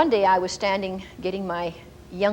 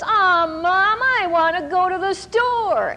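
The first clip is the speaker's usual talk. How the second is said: put-on higher, louder whine of a kid; whining child; 9 semitones higher, 4 dB louder, slower than usual. Son whines to mom for chocolate, higher and louder. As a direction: drag it out like a nagging boy.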